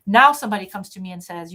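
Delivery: ordinary talk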